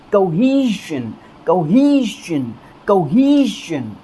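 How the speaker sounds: कोहेशन drawn out slowly, stress on HE; drilling the word; slow repetition. Shown as a demonstration. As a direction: repeat कोहेशन for them to follow. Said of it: "हमारा दूसरा word कोहेशन".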